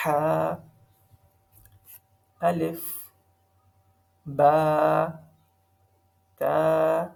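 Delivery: slow, separate letters, with جیم long and pressed; tajweed drill of single letters; letter recitation. Shown as a demonstration. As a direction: model each letter for the class.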